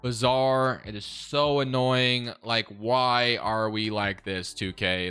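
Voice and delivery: slow, low exasperation, dragging out the key words; exasperated. Real, not acted. Gamer complaining to the game that froze on him, exasperated. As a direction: stretch each complaint out in weary annoyance. Frustrated over the game freeze.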